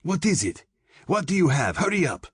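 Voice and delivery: high-pitched urgent demand; frantic, impatient command, pitch way up. A narrator's reading. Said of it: "she commanded".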